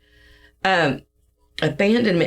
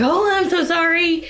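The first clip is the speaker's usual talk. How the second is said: embarrassed apology; 13 semitones higher than usual. Retold or imitated. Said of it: "He was so embarrassed".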